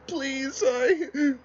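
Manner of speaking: very high, choked sobbing plea. Pretend. Teary cat begging, high choked sob. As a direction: break down crying mid-word. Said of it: "and he starts sobbing".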